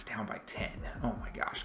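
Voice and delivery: whispered in dismay. Real, not acted. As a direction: breathe it out under your breath. Dismayed at deficit.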